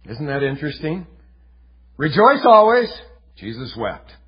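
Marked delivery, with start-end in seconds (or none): 2.0-2.9 loud, high proclamation; proclaimed. Real, not acted. Preacher setting joy against weeping, loud and high. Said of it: "contrasting two verses". 3.3-4.2 soft, low and sorrowful; hushed. Real, not acted. Preacher setting weeping against joy, soft and low. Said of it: "contrasting two verses".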